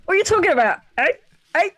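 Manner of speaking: loud, high, challenging outburst; David Turner. Acted. Answering a viewer's request, loud high impression. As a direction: hit it hard like a confrontational impression. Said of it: "do a David Turner's impression".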